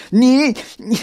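high, loud, indignant sputter; angry. A narrator's reading. Xiaoxin, helpless before the spider, sputters angrily. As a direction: burst out high and stuck for words. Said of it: "小新很生气".

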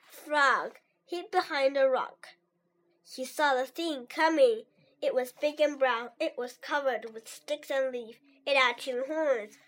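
usual speaking voice

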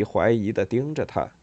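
usual speaking voice

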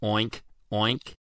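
soft, nasal-free piggy oinks. A narrator's reading. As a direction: grunt it like a happy pig. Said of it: "A happy pig says".

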